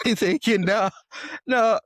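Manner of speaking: high, loud incredulous nah; can't believe what he's hearing; startled disbelief. Retold or imitated. Host retelling the surprise caller, high shocked disbelief.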